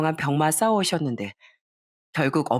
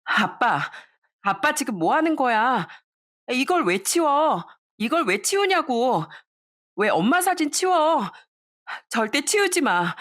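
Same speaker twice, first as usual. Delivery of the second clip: much higher, slow, furious yell; angry yelling; 8 semitones higher, slower than usual. Acted. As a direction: scream at him to stop. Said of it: "아빠에게 버럭 소리를 질렀어요".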